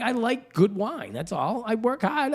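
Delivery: high-pitched, hammed-up protest; indignant self-defense. Parent retelling a spat with the son, indignant protest. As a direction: plead your case, pitch jumping up.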